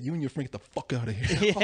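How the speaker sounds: menacing bear threat. Retold or imitated. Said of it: "he said".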